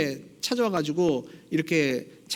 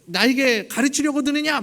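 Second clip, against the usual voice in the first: loud, much higher roar of contempt; furious bellow; 7 semitones higher, 5 dB louder than usual. Retold or imitated. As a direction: shout her down like an outraged nobleman. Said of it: "윽박 지르는 겁니다".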